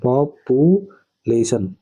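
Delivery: पॉपुलेशन slowly in separated chunks; slow pronunciation demo. Shown as a demonstration. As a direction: model पॉपुलेशन slowly, pausing between its parts. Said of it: "ऐसे पढ़ते हैं".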